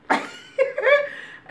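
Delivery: squealing burst of laughter; high laugh. Real, not acted. Laughing at her story.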